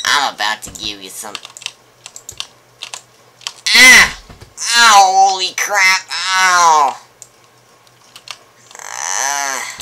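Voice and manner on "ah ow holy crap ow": loud, high cry of pain; pained yelp. Acted. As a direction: yelp as if struck. Villain gets hit.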